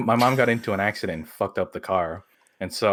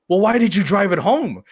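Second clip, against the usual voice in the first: retold angry question; 9 semitones higher, 5 dB louder than usual. Mom and brother angry at them.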